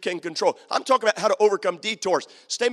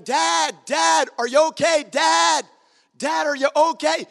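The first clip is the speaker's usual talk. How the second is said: retold yelling; 7 semitones higher, slower than usual. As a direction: call out for Dad across the garden. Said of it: "He was yelling".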